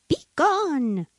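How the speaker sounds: high-pitched, drawn-out dismissal; crying out a command. A narrator's reading. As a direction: send them off with a lofty call. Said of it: "cried to the sparrows".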